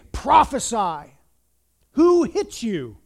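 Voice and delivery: soldier's loud, drawn-out mockery; mocking taunt. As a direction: draw it out to taunt the victim. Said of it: "they would mock him".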